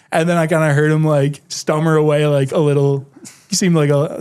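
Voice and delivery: usual speaking voice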